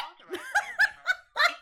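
high, shrieking laugh bursting out; burst of laughter. Real, not acted. Robocall mangles her name, shrieking laugh. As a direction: crack up helplessly.

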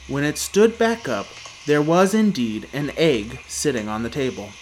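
plain reading voice

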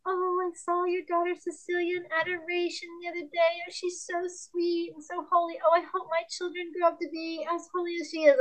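high, sweet imitation of a gushing lady; put-on gushy praise, held high; gushing church lady. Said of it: "a lady said to me".

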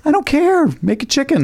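very high-pitched insistence; mock exasperated demand. Customer refusing the burger chain's excuse, exasperated. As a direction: demand it, pitched right up in protest.

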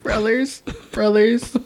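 emotional voice. Pretend. Mock emotional brotherly voice.

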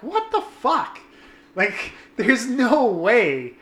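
high, then slow disbelief; a high, incredulous what the fuck. Retold or imitated. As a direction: drag it out in baffled disbelief. Disbelief at ugly guys.

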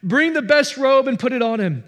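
urgent joy, hurrying the servants; eager father. Acted. Father ordering servants to fetch the robe, excited call. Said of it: "But the father said to his servants".

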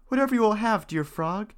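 put-on high, bright-eyed young princess. A narrator's reading. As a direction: lift it up into a girlish princess. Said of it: "said she".

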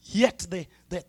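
ordinary talk, nothing marked

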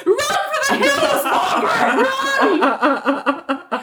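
high, hammed-up alarm call; mock warning cry. Viewer jokingly warns Margaret to flee, high mock alarm. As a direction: cry it out high, half laughing.

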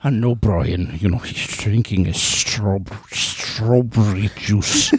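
low, slow lispy impression. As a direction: lisp it slowly, deep. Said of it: "that same kind of lispy".

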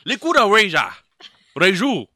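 loud, hammed-up Japanese accent; mock Japanese accent. Man repeats drink's name in loud mock Japanese accent. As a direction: shout it with exaggerated Japanese vowels. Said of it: "This is Japanese".

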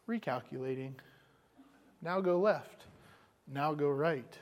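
lightly voiced navigation voice, soft and even; calm GPS voice. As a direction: read it like a patient sat-nav, hushed. Imitating GPS voice again.

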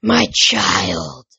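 loud, possessed rasping voice; deep rasp. A narrator's reading. Possessed mother crying out over her son, rasping voice. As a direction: rasp it out with force. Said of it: "she rasped in a much deeper voice".

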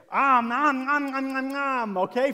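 playful om nom chewing sounds; munching noises. Pretend. Preacher miming eating the fruits of the Spirit, playful munching sounds. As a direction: gobble it up like a hungry kid.

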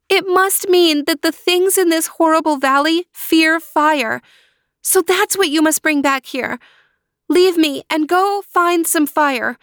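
put-on high, pleading young girl voice; high young sister pleading; girl calling out. A narrator's reading. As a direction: call to your brother through the wall. Natalia urges Luis.

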